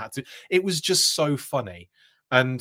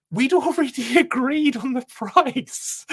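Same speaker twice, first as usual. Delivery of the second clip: laughing; 12 semitones higher, 3 dB louder, slower than usual. Retold or imitated. Defending himself, laughing.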